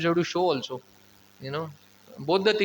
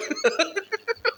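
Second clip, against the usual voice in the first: a high, incredulous laugh; wife's nervous laugh at her raging husband; high startled laugh; 13 semitones higher than usual. Retold or imitated. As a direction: laugh in disbelief at the sight of him. Lakshmi's bewildered laugh.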